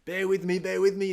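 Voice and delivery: mock pleading cyclist; imitating a cyclist. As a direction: play the dawdling cyclist pleading. Mimicking slow cyclist on hill.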